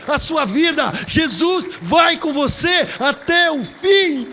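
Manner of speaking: high, loud shouts in punched bursts; full-force preaching. Real, not acted. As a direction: proclaim it to the congregation, drawing out each promise. Preaches the climax. (pregação aos brados, com força total)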